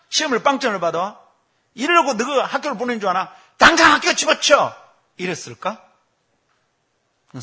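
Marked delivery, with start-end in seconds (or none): 0.1-4.7 loud, high tirade building to a shout; play-acted parental yelling; mock angry scolding. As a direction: explode like a furious father. Hypothetical father scolding over zero score.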